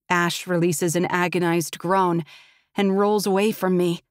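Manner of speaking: usual speaking voice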